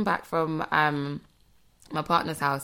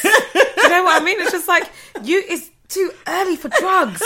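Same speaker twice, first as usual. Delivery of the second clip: high, loud protest through real laughter; laughing; 12 semitones higher, 7 dB louder than usual. Real, not acted. Friend joking about Americans too early, laughing. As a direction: laugh through the complaint, voice riding up.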